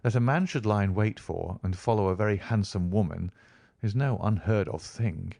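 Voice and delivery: usual speaking voice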